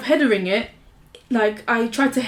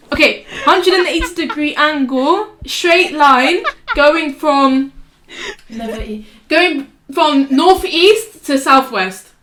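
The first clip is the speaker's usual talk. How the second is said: slow explaining; 4 semitones higher, 6 dB louder, slower than usual. Shown as a demonstration. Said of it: "Can you imagine that now?".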